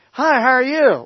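high, loud, sing-song friendly hello; chirpy greeting. Retold or imitated. Two strangers come up to him in Boston, chirpy imitated greeting.

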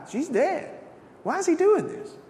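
high, incredulous scoff; mock the healer in disbelief; a mocking onlooker, pitched high; scoffing crowd. Crowd laughing at Jesus, incredulous.

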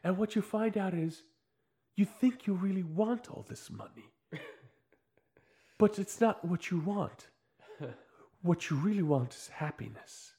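put-on self-help expert voice; measured mock-guru explanation; mock MBA expert. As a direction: imitate an earnest expert, pausing between phrases. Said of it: "then the N B A comes on".

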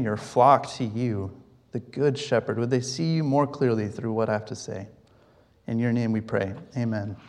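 hushed congregational prayer; quiet prayer. Acted. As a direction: pray humbly to the Lord. Leads a prayer.

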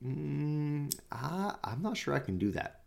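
soft, doubtful murmur to himself; a quiet unsure conscience; hesitant inner voice. Retold or imitated. Officer's conscience doubting the order, softly hesitant.

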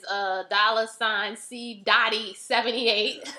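slow and deliberate dictation; slow spelling out. Real, not acted. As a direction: spell each part out slowly. Spelling out Cash App handle.

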